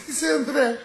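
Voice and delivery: very high, small interjection; high squeaky barfly butting in; slumped drunk's little voice. Acted. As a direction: pipe up drunkenly from the table. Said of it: "está uno chupadazo tirado arriba de la mesa".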